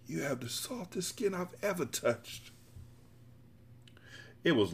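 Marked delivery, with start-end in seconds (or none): 0.1-2.5 soft, intimate lover's murmur, pitched up; higher, much softer character voice; hushed sexual voice. A narrator's reading. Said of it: "he said in a low sexual voice".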